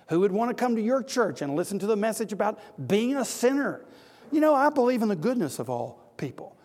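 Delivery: mock-offended, lifted voice throughout; scornful objector. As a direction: play an affronted critic of the message. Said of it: "You see how the scorn comes on the gospel".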